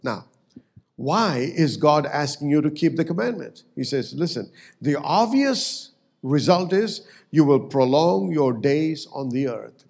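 usual speaking voice

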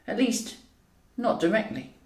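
usual speaking voice

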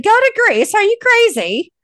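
mock-outraged father balking at his son; incredulous dad. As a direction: shoot the idea down as if it's absurd. Father scoffs at son's advice.

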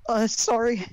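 put-on high, loud girlish sorry; meek flustered sorry; sheepish apology. Ruby apologising retake.

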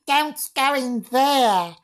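put-on very high, slow ghostly girl; loud, put-on high girl voice; young girl's voice. Shown as a demonstration. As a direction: wail a warning as a little girl. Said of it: "I can say it in a girl".

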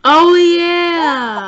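loud, high, drawn-out cheer; excited agreement. Real, not acted. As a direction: whoop it with eager anticipation. Eager for the party scene.

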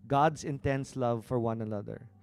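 loud, driving sermon delivery; preaching at full force. Acted. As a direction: preach it out to the congregation.

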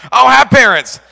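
high, loud panicked yelp; panicked blurt. Retold or imitated. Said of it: "So I panicked again and went".

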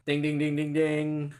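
high chanted ding ding; mock bell. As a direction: chime it like a game-show bell. Answer-is-right bell.

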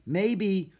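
put-on young Jack, unsure; boy's voice. Jack's voice in story.

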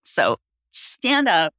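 slow, soft instruction to stretch and lift; soft coaching guide. Shown as a demonstration. Coach to client during stretch, softly guiding. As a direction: guide him gently upward. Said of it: "coach guiding a stretch".

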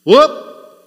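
sudden high shouted whoop; one piercing call to the sheep; one loud whoop. Retold or imitated. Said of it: "He let out one whoop".

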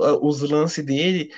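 ordinary talk

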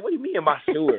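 exasperated echo of the stranger's words; incredulous retort. Retold or imitated. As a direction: throw her question back at her. Incredulous at her persistence.

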